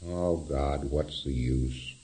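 low, soft, slow acted sigh of futility; mock despair, voice sunk down; hopeless resignation. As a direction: act utterly resigned, dragging the words out. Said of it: "go up to a point of".

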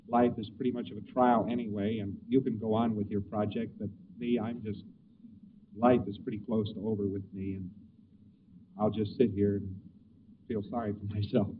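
a grieving man who sees no use going on; grief response. Retold or imitated. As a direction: sink into a hopeless self-pitying murmur. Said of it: "there's your grief response".

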